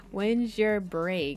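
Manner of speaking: slow, stretched delivery; drawn-out mock question. Player voicing a line to the waitress, slow and drawn out. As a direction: draw out each word of the question. Replays the line more slowly, hammed-up.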